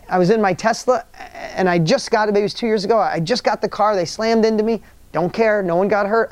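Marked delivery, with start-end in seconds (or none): none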